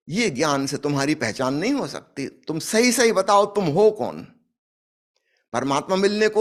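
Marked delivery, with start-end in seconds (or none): 2.3-4.2 stern demand. Acted. Sadhu demands an answer from the king.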